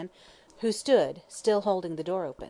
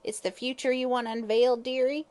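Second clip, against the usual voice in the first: higher, wheedling hag's croak; croaking fortune teller; 6 semitones higher than usual. Acted. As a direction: coax him like a sly old witch. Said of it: "she croaked".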